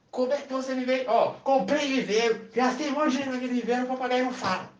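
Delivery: high exasperated complaint; indignant complaint. Acted. Woman complains to the pet shop man again. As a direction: vent about the money spent.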